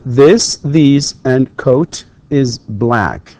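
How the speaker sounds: walk the class through the sentence slowly; slow, deliberate reading. Shown as a demonstration. Teacher reading the exercise to students, very slow. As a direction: read each word slowly.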